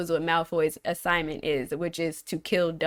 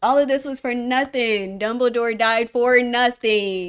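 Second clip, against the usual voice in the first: dismayed outcry; 4 semitones higher, 6 dB louder than usual. Real, not acted. Lamenting Harry's wasted effort.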